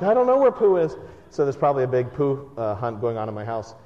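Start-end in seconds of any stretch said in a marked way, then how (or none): 0.0-1.0 upset little daughter. Retold or imitated. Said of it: "She's probably like".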